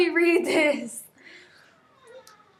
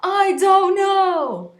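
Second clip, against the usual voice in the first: loud, high, slow yell; frustrated shout; yelling back; 6 semitones higher, 5 dB louder, slower than usual. A narrator's reading. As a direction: yell it back in his face. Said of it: "I yelled back at him".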